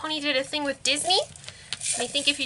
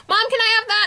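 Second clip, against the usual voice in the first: put-on a little kid pleading, higher and louder; child begging; 7 semitones higher, 5 dB louder, faster than usual. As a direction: beg mom like a small kid. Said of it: "And I'm like".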